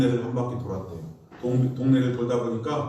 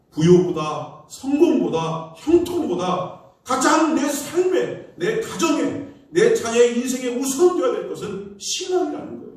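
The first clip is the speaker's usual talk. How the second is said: rising preaching climax; 9 semitones higher, 4 dB louder than usual. Acted. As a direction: build each phrase higher like a pulpit peak.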